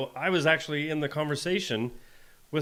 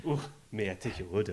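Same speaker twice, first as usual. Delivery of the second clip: softer, lower, charming voice; Pedro Pascal voice; 5 semitones lower, 7 dB quieter than usual. Pretend. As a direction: purr it out like a heartthrob. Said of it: "Maybe they should have Pedro Pascal".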